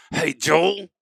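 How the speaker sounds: scolding mama. Retold or imitated. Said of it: "your mama said".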